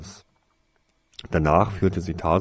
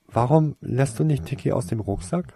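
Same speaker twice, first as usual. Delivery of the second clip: lightly curious, higher-pitched question; interested; 6 semitones higher than usual. A narrator's reading. Girl asking about the animal in his rucksack, interested. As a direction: ask with raised, inquiring voice. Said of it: "fragte sie interessiert".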